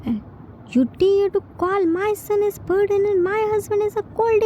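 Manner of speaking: high, fast, outraged; furious rebuke. Acted. Yoongi furious at his mother for calling his son a burden, high and outraged. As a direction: throw her insult back at her.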